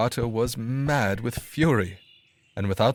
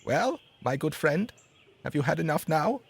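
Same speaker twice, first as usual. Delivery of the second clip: sparrow; 7 semitones higher than usual. A narrator's reading. Sparrow checking on the full dog, higher bird voice. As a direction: ask it as the small sparrow, unhurried. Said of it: "the sparrow said to him".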